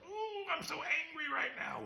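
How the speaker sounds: mock childish shout of anger; childish tantrum. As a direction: throw a little kid's tantrum. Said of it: "like a child".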